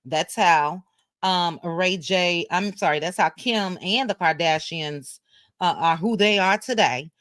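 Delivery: ordinary talk, nothing marked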